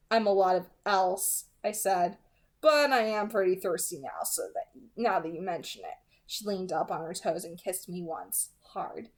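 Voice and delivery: hushed, intimate audiobook reading; soft story narration. A narrator's reading. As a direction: read it softly, drawing the listener in. Reading novel narration aloud.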